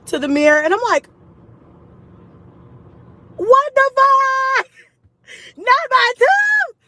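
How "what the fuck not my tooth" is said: slow, high, loud wail of dismay; distressed shout. Retold or imitated. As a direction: cry out in horror, dragging the words. Sees broken tooth in mirror.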